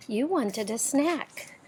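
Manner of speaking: usual speaking voice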